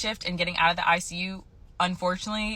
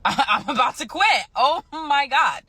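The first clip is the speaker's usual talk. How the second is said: giddy, higher-pitched and half laughing; excited; 7 semitones higher, 4 dB louder than usual. Real, not acted. Nurse realizing she is about to quit, giddy excitement. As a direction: let the excitement burst out, higher and drawn out. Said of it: "You sound so excited".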